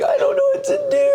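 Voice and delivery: very high squeaky imitation of a crying friend; hammed-up falsetto whine; mock wailing. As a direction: squeal it helplessly, far above your own voice. Imitates the bawling friend on calls.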